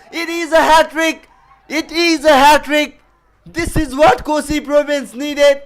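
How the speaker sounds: high, loud hat-trick call; excited commentator. Real, not acted. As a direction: scream the wicket with joy. Hat-trick wicket called live.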